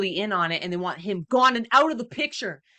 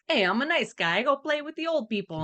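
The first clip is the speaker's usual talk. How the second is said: higher-pitched, mock-innocent friendliness; lightly voiced nice guy; 8 semitones higher than usual. Gangster posing as nice to old people, lightly voiced. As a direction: play a harmless pal, pitched up. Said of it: "he likes to be like".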